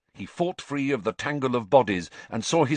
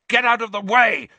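much higher, louder shout; 11 semitones higher, 8 dB louder than usual. A narrator's reading. Harry warning Ron and Hermione off, loud shout. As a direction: yell a command to clear the way. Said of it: "he shouted at Ron and Hermione".

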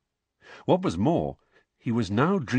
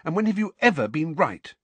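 heated; 8 semitones higher than usual. A narrator's reading. Hermione argues, higher.

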